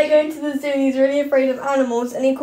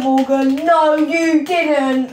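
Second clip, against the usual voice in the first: quoting a viral line; 3 dB louder, much slower than usual. Retold or imitated. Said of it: "Have you seen that?".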